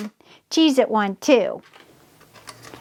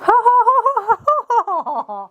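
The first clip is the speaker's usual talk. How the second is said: giddy laugh; 10 semitones higher than usual. Real, not acted. Crafter laughing as her clock starts ticking, giddy laugh. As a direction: laugh with sheer delight.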